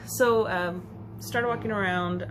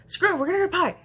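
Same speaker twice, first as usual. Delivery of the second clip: higher, louder, reckless cheer; defiant excited friend; 11 semitones higher, 8 dB louder, much faster than usual. Retold or imitated. Friend shrugging off her wheat allergy, excited and loud. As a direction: throw caution to the wind about pie. Friend decides to get pie.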